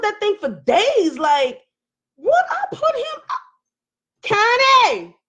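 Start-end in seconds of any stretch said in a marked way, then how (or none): 2.2-5.2 very high, drawn-out mock-agonized holler. Streamer battling with the question calls out to Connie, hollering. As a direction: wail the question, then holler the name. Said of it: "why am I hollering".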